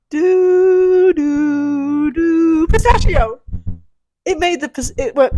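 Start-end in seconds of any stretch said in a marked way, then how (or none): 0.1-3.4 high, loud sung "doo, doo, doo, pistachio"; sung toilet flush. Shown as a demonstration. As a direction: sing the flushing toilet gurgle. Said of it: "Here we go".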